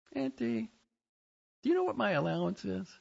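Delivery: high-pitched little niece; high, quick kid voice; child's voice. A narrator's reading. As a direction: pitch it up like a child asking Auntie. Niece voiced asking her aunt.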